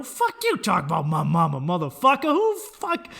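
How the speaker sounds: loud, high furious comeback; angry yell. Retold or imitated. Defending his mama to an online troll, loud angry yell. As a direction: yell it back at him. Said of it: "And I got all angry".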